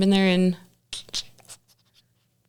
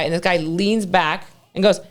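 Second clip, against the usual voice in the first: usual speaking voice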